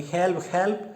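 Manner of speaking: high, loud cry for help; loud scream. Acted. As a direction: scream for help as the kidnapped girl. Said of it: "Silver screams loudly".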